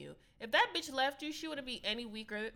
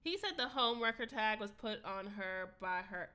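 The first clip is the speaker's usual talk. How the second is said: ordinary talk, nothing marked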